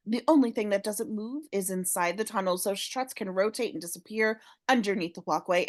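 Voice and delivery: normal talking voice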